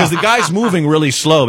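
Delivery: ordinary delivery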